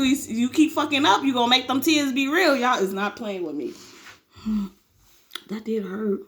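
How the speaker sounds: usual speaking voice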